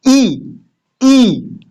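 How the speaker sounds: drawn-out ई, held long. Shown as a demonstration. Teacher showing students a long ई, drawn out. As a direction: stretch the ई sound out. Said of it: "थोड़ा ज्यादा बोलते हैं ना खींच के".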